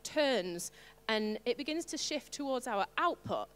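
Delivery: ordinary talk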